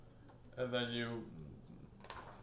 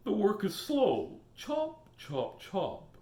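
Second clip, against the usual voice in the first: beaver voice; 7 semitones higher, 3 dB louder, much slower than usual. Acted. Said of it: "says the beaver".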